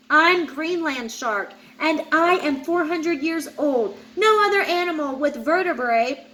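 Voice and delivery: ordinary delivery, nothing marked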